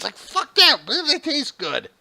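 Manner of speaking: high, loud and indignant; outraged high rant. Real, not acted. Host ranting that porgs taste good, high and loud.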